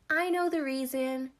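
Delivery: put-on high, loud child's call; gleeful shout. A narrator's reading. Seven-year-old sister revealing she knows, high shout. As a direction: shout it out like a gleeful little girl. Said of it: "shouted the seven-year-old sister".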